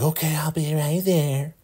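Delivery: very high falsetto imitation; Joan. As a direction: squeal it in a put-on falsetto. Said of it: "You know how she talks".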